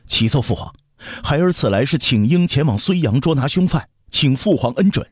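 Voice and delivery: put-on high-pitched boy making a formal petition; childish young voice. Prince kneels to petition his father, childlike and earnest. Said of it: "传来稚嫩的声音".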